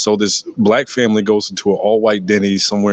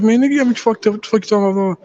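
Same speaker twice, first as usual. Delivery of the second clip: put-on much higher, casual teen voice; 12 semitones higher, much faster than usual. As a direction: mimic a boy riffing with his friend, pitched way up. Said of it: "And he's just like".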